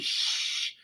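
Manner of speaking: a voiceless hushing shh. Retold or imitated. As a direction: hush the room as the boss walks in. Hushing coworkers as boss arrives.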